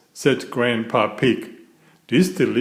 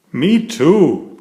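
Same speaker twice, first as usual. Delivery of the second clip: higher, louder cheery reply; shouting back; 5 semitones higher, 6 dB louder than usual. A narrator's reading. Daddy Pig to Peppa while pouring concrete, shouting back. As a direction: call it back with gusto. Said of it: "Daddy Pig shouted back".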